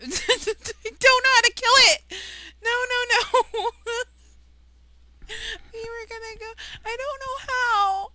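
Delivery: high, loud panicked wail; panicked laughing. Real, not acted. Can't kill the mob, panicking.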